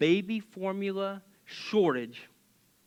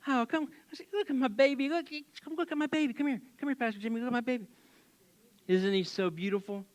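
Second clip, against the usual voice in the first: doting mama; 4 semitones higher, 6 dB quieter, faster than usual. Retold or imitated. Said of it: "They're like".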